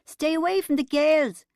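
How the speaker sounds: loud, high warning shout; angry yell at his back; bellow. A narrator's reading. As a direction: bellow it after someone running off. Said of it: "Barry Grant bellowed after him".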